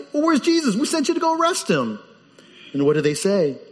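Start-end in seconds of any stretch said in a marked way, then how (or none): 0.1-2.0 higher-pitched, demanding priest voice; indignant chief priests. Acted. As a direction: act out the priests demanding answers. Chief priests question the officers.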